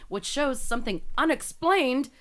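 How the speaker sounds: emphatic, dramatic article reading; news read. Acted. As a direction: build intrigue as you read the report. Reads the news story aloud.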